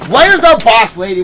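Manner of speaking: hammed-up summons; mock calling out. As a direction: call out as if searching the room. Calling out mockingly.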